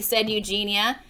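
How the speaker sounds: ordinary delivery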